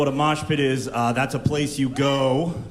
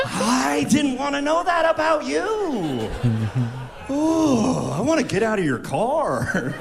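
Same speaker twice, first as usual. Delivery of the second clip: high, horrified mock voice; shocked passenger; 6 semitones higher, slower than usual. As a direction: play the appalled passenger squirming.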